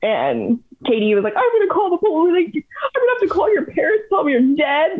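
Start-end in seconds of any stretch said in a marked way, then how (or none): 1.3-5.0 high-pitched mock panic; frantic retold threat, squeaky high; imitated freak-out. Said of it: "complete freak out".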